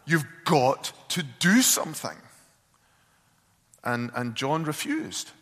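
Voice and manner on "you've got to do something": sharp raised call of his name, then pressing on; louder and higher, demanding he act; yelling at husband. Retold or imitated. Wife yells at passive husband to act, loud. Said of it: "she yelled at John".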